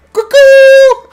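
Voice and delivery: high, loud cuckoo cry; zany cuckoo call. Acted. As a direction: squawk it like a cuckoo clock.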